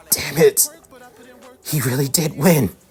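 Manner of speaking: anguished, high-pitched outburst; Bakugo. A narrator's reading. Bakugo learns Izuku won and beat him, anguished outburst. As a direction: build from stunned disbelief to a high, wailing rage. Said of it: "as tears continue to slip".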